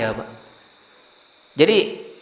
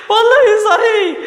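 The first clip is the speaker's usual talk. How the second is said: laughing insistence; 12 semitones higher than usual. Real, not acted. Preacher assures listeners the story is true, laughing. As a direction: swear it is true while cracking up.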